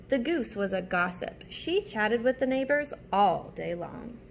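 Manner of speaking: ordinary delivery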